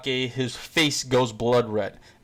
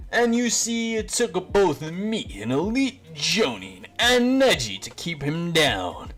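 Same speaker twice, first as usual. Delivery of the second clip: Guy's boisterous voice; 5 semitones higher than usual. Retold or imitated. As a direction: play the overexcited sensei bragging about his team. Guy tells the story.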